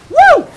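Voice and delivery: short, high, loud "woo"; excited whoop. Real, not acted. Whoops while playing the match. (hoge, luide juichkreet)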